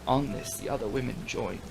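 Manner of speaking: usual speaking voice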